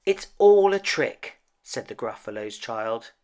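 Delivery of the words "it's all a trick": loud, high indignant cry; defiant shout. Acted. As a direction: call it out, refusing to be fooled. Child declares defiance.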